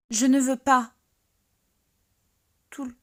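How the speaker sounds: high, loud outburst; loud protest. Acted. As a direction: blurt out a loud refusal.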